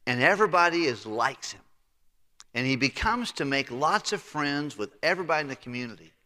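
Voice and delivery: ordinary talk, nothing marked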